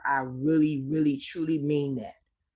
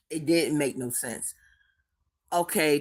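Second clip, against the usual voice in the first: ordinary delivery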